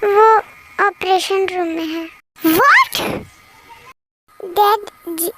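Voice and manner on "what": high, loud rising question; shocked cry. Acted. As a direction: burst out in shocked disbelief. Hears of the operation.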